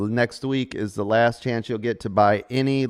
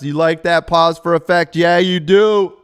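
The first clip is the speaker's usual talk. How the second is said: mock dramatic, higher and louder; hammed-up drama voice; 6 semitones higher, 7 dB louder than usual. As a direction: ham it up like a stage actor. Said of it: "I wasn't even in drama class".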